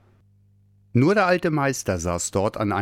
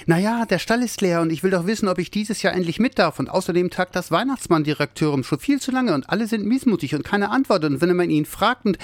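little Santa rushing his story, high and breathless; words bubbling out; 9 semitones higher than usual. Acted. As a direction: pour it all out without a breath. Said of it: "Die Worte sprudelten nur so".

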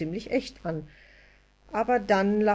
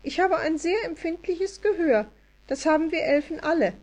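much higher, fast fairy voice; tiny elf voice; 10 semitones higher than usual. A narrator's reading. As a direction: rattle it off as a little elf. Elf explains her hearing.

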